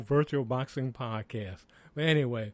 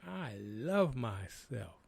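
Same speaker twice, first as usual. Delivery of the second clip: slow, soft, loving words to yourself; tender self-affirmation; 4 semitones lower, 5 dB quieter, much slower than usual. Acted. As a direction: say it gently, like a hug in the mirror. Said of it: "hug yourself and say".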